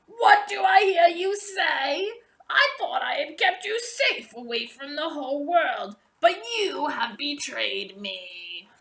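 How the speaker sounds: high, loud witch's rage fading to bitter; furious sorceress. A narrator's reading. Sorceress raging at Rapunzel, then bitterly accusing her. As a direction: rage at her, then let it sink to hurt. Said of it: "she shrieked".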